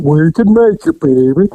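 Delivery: mushy, mouth-full imitation of Charles; mumbled Charles voice. Officer fakes Charles's mumble to fool the lookout. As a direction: mumble like your mouth is stuffed. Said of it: "Get that shit out of your mouth and talk right".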